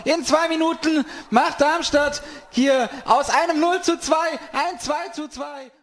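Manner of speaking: excited goal call. Real, not acted. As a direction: call it in disbelief and joy.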